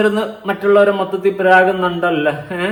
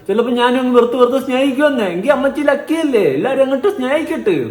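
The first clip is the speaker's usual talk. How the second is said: higher, teasing sarcastic lilt; mocking sing-song; 8 semitones higher than usual. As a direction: needle her with mock sweetness. Mocking the mother's confidence.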